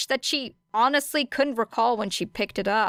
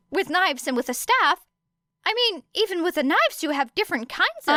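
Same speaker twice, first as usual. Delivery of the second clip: higher, fast eager questioning; eager rambling; 9 semitones higher than usual. A narrator's reading. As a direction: pepper her with excited questions. Midoriya rambling eager interrogation.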